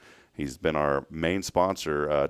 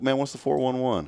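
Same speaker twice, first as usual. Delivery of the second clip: casual street slang, lightly voiced; slang greeting voice; 7 semitones higher than usual. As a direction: toss it off like old-school slang. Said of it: "Didn't people used to say that?".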